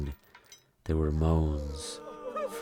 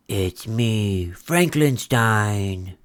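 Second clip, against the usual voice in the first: spooky voice; 3 semitones higher, 4 dB louder, much slower than usual. Acted. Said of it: "In his deepest, spookiest voice".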